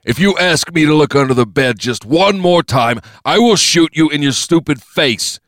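loud, high-pitched angry outburst; angry threat. Acted. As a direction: threaten them at the end of your patience. Threat to shoot in the face.

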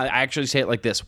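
usual speaking voice

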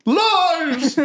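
high, sharp dramatic denial; villain denial. Acted. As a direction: snap back as the villain. Villain denies accusation.

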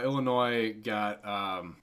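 ordinary talk, nothing marked

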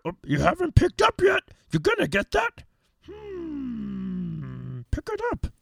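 high-pitched teasing nag; high ringtone voice. Pretend. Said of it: "listen to a few of the ringtones".

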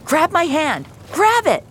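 high, loud cry of command; urgent shout. Acted. Rescuer to girl in a burning car, urgent shout. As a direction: shout it like a life depends on it. Rescuer urging child out of wreck.

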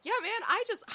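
high, loud burst of pretend confidence; mock bragging. Host imagining calling a manager after auditioning, mock bragging. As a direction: play the pumped-up actor after an audition.

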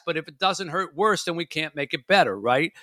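ordinary talk, nothing marked

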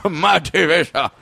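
put-on movie-star voice; Jeff Bridges. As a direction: ramble like an imitated film star. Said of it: "talking like Jeff Bridges".

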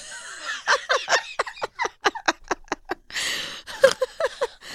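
high squealing laugh; wild laughter. Real, not acted. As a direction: lose it completely laughing. Loud laugh, no words.

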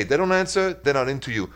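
normal talking voice

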